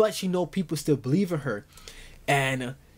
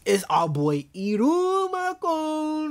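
slow, drawn-out, much higher hype call; hyped reveal; 12 semitones higher, much slower than usual. Real, not acted. Vlogger revealing Iruma in the crowd, slow high hype. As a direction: stretch it out like announcing a champion.

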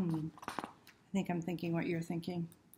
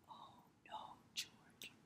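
soft breathy whispered run-through; whispered rehearsal; 19 dB quieter, slower than usual. Shown as a demonstration. Reader quietly rehearsing the refrain, in a whisper. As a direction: hush it before the big cry. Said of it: "Get ready".

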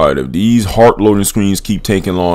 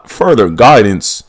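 ordinary delivery

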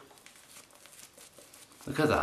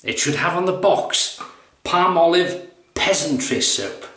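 higher, sneering posh snob; shaving snob; 7 semitones higher than usual. Retold or imitated. Snob mocking the soap's label, hammed-up sneer. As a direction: sneer at the soap from on high. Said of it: "the shaving snob".

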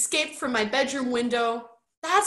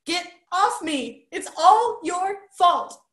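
sobbed accusation; 10 semitones higher, 6 dB louder than usual. A narrator's reading. Roderick in tears blaming Bert, high loud sobbing protest. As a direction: wail the blame out. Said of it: "sobbed Roderick".

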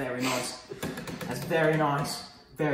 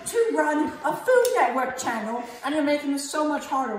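mock rant; 8 semitones higher than usual. Host ranting at cameraman.

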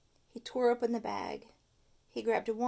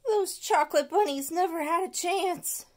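higher, louder mock moan; 7 semitones higher, 5 dB louder than usual. Stuart moaning over his aching stomach. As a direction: moan it with a bellyache. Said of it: "moaned Stuart".

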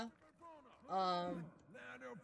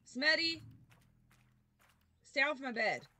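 loud, raised scold; calling out to pet; 5 semitones higher, 5 dB louder than usual. Real, not acted. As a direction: shout across the room at the pet.

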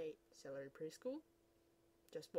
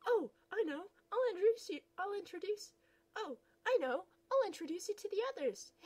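eager gushing; 9 semitones higher, 7 dB louder than usual. Acted.

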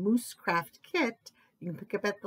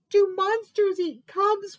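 high, loud frightened whimper; high-pitched worried question; whimpering cub; 11 semitones higher, 5 dB louder than usual. A narrator's reading. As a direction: whimper it like a scared little cub. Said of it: "whimpered Wolf".

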